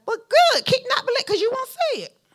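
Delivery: high-pitched, loud sarcastic go-ahead; mock approval. Preacher answering someone who won't believe, mock approval. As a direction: toss back a high, exaggerated "suit yourself".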